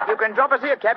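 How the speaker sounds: high, loud call to a driver. Acted. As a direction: call up to the cabby. Calling to the cab driver.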